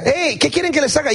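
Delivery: high-pitched acted holler; shouting from afar. Jesus hailing the blind men from afar, calling out. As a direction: yell it over to someone far off, high. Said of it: "Les grita y les dice desde allá".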